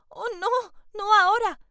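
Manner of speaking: sudden high, loud panicked cry of no. Acted. Girl panics as Julián reaches for her face, high loud cry. Entré en pánico.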